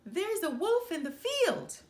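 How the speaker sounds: high, loud urgent call; a boy faking panic, loud; alarm shout. A narrator's reading. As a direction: yell the warning across the field. Boy's false alarm shout.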